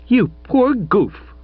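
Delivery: high, slow, indignant cry; young woman crying out in exasperation; cried out. A narrator's reading. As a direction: fling the insult at him. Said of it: "she cried".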